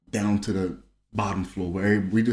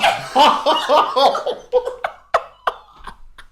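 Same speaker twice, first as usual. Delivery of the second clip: high laugh; 5 dB louder than usual. Real, not acted.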